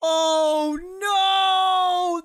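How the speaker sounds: loud high oh no; dismayed shout. Real, not acted. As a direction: cry out as it smashes. TV falls in fail clip.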